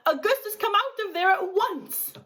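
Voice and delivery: high, loud mother's call; mother yelling up the pipe at her son; yelled call. A narrator's reading. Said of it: "yelled Missus Gloop".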